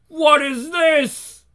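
high, slow shocked question; startled cry. Acted. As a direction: recoil in shock. Villain startled.

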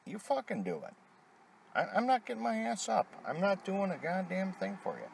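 high-pitched retold defiance; retold snapping. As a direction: re-enact a testy refusal without raising the volume. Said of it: "snapped at her the other day".

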